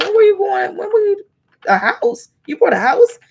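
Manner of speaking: high, loud squeal of disbelief; shocked friend. Retold or imitated. Friend at the housewarming hears about the house, shocked loud squeal. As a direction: shriek it like a friend who just found out.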